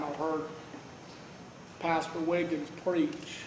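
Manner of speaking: normal talking voice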